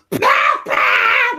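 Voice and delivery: put-on high, loud squealing little kid; toddler shriek. Two-year-old spotting grandpa, high excited shriek. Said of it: "two-year-old goes".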